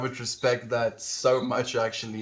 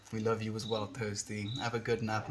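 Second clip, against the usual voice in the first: ordinary talk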